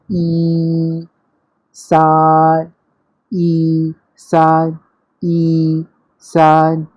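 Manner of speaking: "ई" and "सान" both held high and level at one pressure; first tone drill. Shown as a demonstration. Teacher drilling first-tone words, steady high level pitch. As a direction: keep "सान" long, high and even like "ई". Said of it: "एक ही pressure पे बोला है".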